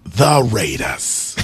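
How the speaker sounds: put-on low, slow accented announcer call; Berman impression with an accent. Asked about his accent, redoing his Berman low and slow. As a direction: lean low and slow into the Raiders. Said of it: "what's the accent you're saying when you're doing your Berman".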